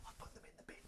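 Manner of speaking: barely audible whispered reply. Shown as a demonstration. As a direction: whisper your line into the gap. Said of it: "And then you'd whisper".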